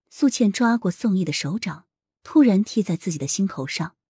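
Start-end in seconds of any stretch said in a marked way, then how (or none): none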